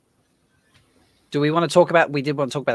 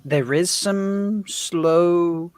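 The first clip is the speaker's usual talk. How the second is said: slow drawl; 6 semitones higher, 3 dB louder, much slower than usual. Shown as a demonstration. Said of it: "sort of Southern drawl there to it".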